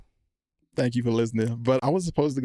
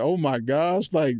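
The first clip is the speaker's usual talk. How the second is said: overwhelmed joy; 7 semitones higher than usual. Retold or imitated. Retold answer to God.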